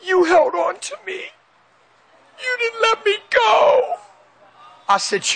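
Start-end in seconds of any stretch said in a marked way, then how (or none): 0.0-4.0 very high, slow, crying voice; imitated tearful wife. Wife realizes husband held on, imitated high tearful cry. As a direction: mimic her overcome, drawing out each word.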